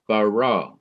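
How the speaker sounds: bara with the final "ra" hit heavily and held; heavy stress on RA. Shown as a demonstration. Teacher showing why the accent matters, heavy stress on ra. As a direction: come down hard on the second syllable of bara. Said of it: "I come down heavily on the ra".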